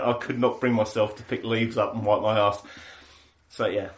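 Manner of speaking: usual speaking voice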